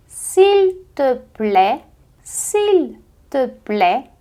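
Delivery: s'il te plaît stretched very slowly; slow French demo. Shown as a demonstration. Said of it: "Repete comigo". (s'il te plaît bem devagar)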